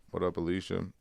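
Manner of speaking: ordinary talk, nothing marked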